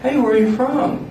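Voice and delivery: high, chirpy stranger asking; mock friendly stranger. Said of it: "they're always like".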